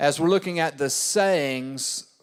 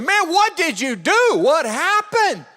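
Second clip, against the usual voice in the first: much higher, louder, urgent; urgent pastor; 11 semitones higher, 4 dB louder than usual. Retold or imitated. Pastor urgently asking what the teen did, high and pressing. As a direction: demand to know, pitch up. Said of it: "I said".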